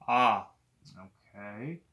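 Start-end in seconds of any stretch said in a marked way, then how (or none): 0.1-0.5 loud aah with mouth wide open; tongue held down saying aah. Shown as a demonstration. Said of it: "Can I get you to say, "Ah," for me?".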